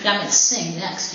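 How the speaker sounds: ordinary talk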